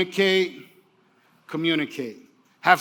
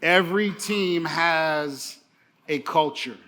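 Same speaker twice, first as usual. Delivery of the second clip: slow, with a pause before the last words; slow and deliberate; slower than usual. Shown as a demonstration. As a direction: spell it out slowly so they can take notes. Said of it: "When I pause, that may be a good place to jot a note down".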